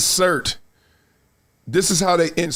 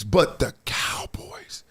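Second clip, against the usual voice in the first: whispered awe; 3 semitones lower, 7 dB quieter, much slower than usual. Retold or imitated. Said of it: "he's like".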